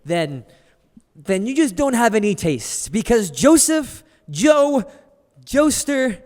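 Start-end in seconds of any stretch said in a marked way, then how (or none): 2.9-6.2 louder, higher name build-up; game-show host hyping a guest; hype intro. Acted. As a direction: announce him like a star.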